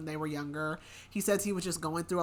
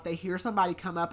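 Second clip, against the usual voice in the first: normal talking voice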